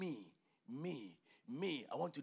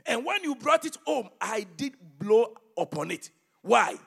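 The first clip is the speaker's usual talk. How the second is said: preaching at full force; 4 semitones higher, 12 dB louder than usual. Acted. Preacher reading Haggai aloud to his church, booming. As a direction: thunder the prophet's words over the congregation.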